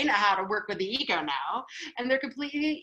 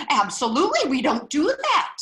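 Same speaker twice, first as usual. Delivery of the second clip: mock indignation; 4 semitones higher, 5 dB louder than usual. Voicing rule-bound soul's indignant answer.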